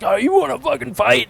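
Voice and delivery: mock the wannabe fighter; drunk brawler. Mocking a drunk guy picking a fight on the river. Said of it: "he's like".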